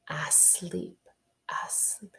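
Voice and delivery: "Asleep" whispered softly, fading away. Shown as a demonstration. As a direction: breathe the word out under your breath. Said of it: "That sounds like the word".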